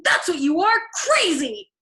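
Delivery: high, loud shrieked accusation; shrieking. A narrator's reading. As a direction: scream that he's mad.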